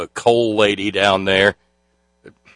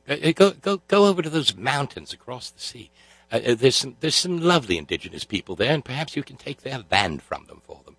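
soft, hammed-up British accent; Mother England sweetly sending folks away in an English accent; mock English accent; 8 dB quieter than usual. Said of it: "The folks that Mother England".

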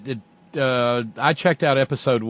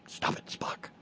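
whisper; 15 dB quieter than usual. Acted. Muttered aside to himself.

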